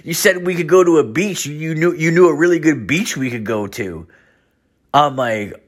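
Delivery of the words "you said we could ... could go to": much higher, goofy dude voice; high mock voice of the buddy; college buddy voice. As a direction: play the clueless old friend high. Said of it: "He's like".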